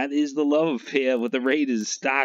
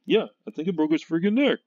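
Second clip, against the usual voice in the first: quick, higher, mock-stunned big guy; imitating a big actor, fast and higher; mock Richard Kiel quote; 6 semitones higher, faster than usual. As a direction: blurt it like the giant stunned at his own strength. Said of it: "And he's like".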